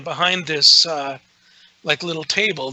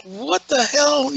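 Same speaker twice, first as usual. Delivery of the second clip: high, drawn-out mock astonishment; retold disbelief; 10 semitones higher, slower than usual. Investigator looking at his psychic friend in shock, retold disbelief. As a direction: stretch it out high, as if baffled. Retelling his baffled reaction to Bobby.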